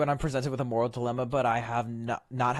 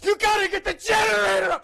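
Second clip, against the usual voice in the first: much higher, louder frantic yell; mock panicked shout; 8 dB louder than usual. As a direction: ham up the panic. Voices panicking game characters.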